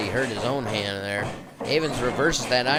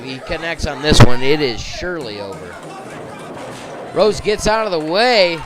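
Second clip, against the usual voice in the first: wrestling commentator; 3 semitones higher, 6 dB louder than usual. Real, not acted. Near fall in live match.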